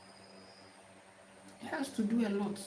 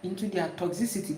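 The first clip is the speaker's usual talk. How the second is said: usual speaking voice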